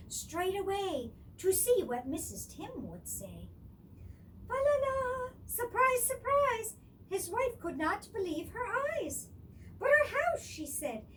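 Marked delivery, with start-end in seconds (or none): 4.5-6.8 high, sing-song surprise call; cheery surprise. Acted. Tim brings the tree top home to his wife, high cheerful call. 9.8-10.4 high surprised protest. Acted. Wife protests.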